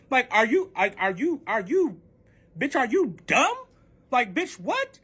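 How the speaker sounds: exasperated, swooping retold questions; incredulous challenge. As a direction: stammer it in disbelief, pitch jumping. Retold quarrel, disbelieving questions.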